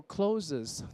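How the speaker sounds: CLOTHES wrongly said as "clo-zes"; two-syllable "clothes" with added -es; extra syllable. Retold or imitated. Teacher saying there is no such word, clo-zes. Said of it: "没有clothes这个字了".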